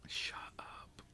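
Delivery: normal talking voice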